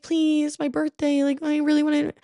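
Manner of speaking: put-on pleading girl.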